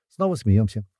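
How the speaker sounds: ordinary delivery, nothing marked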